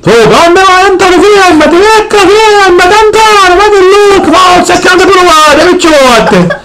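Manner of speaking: put-on high, eager kid; excited child voice. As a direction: chirp it like a small child. Plays the child.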